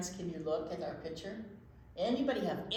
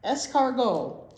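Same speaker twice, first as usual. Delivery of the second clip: Escargot proclaimed louder and slower like a grand title; louder, slowed title read; announcing the title; 3 semitones higher, 10 dB louder, slower than usual. Acted. Reading the book title.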